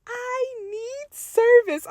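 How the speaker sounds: high, drawn-out pretend wail; mock screaming car. Tiffany the car begging her owner for service, mock screaming. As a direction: plead like a car screaming for dear life. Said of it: "screaming at the top of her lungs".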